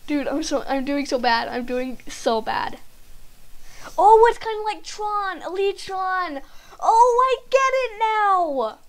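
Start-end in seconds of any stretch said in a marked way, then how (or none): 4.0-8.8 high, drawn-out, slow exclamation; excited realization. Real, not acted. Player realizing the game is like Tron, excited realization. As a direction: stretch it out as it dawns on you. Suddenly understands how game works.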